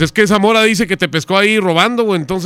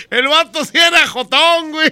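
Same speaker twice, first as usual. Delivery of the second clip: laughing; 8 semitones higher than usual. Real, not acted. Host laughs after prank call.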